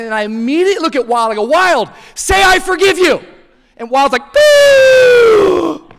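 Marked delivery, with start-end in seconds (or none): 1.4-3.2 high shouted parental order; loud, insistent dad shouting across water; urgent demand. Retold or imitated. Dad to his half-drowned son, urgent demand. 4.3-5.9 very high, loud drawn-out splutter; drowning gasp noise. Retold or imitated. Half-drowned boy answering his dad, loud splutter.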